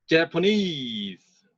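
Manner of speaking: very slow Japanese, main beat on NESE; stress on -NESE. Shown as a demonstration. Teacher drilling Japanese with final stress. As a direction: drag out Japanese with weight on the last syllable. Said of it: "Japanese 할 때는".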